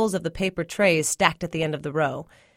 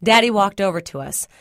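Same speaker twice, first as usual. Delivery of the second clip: ordinary delivery, nothing marked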